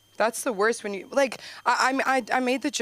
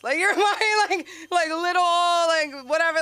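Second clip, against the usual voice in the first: high, loud, laughing rebuttal; mock exasperated retort; 11 semitones higher, 6 dB louder than usual. Daughter arguing back about piercings, mock exasperated. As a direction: argue back in a hammed-up squeal.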